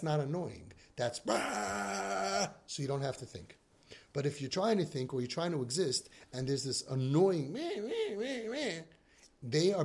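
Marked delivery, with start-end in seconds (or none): 1.2-2.5 mock blast noise. Imitating mind-numbing loud music. 7.8-8.8 high, nasal-free repeated bleat imitating an irritating sound; mock annoying noise.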